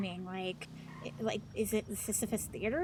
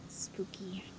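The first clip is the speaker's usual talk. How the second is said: whispering; 7 dB quieter than usual. Pretend. Joking about scream, spooky.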